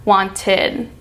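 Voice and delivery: "Wanted" ending in a light "id"; "Wanted" with the tongue tip on the roof of the mouth for "id". Shown as a demonstration. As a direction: end "Wanted" with an open "id". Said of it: "It ends with your tongue at the roof".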